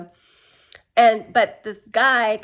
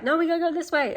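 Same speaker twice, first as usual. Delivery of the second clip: higher-pitched mock objection; retold insistence; 7 semitones higher, faster than usual. She argues with him over the way, insistent. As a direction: push back, imitating her with raised pitch. Retelling her arguing back about direction.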